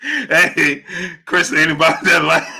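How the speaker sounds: real laughter breaking into the words; laughing to tears. Real, not acted. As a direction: say it while cracking up. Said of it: "oh, you got me in tears".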